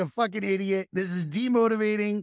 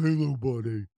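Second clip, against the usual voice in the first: low, lumbering dog voice; big dog voice; 7 semitones lower than usual. Acted. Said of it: "I give it a voice".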